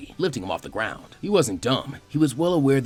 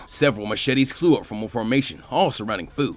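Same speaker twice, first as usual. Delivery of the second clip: plain reading voice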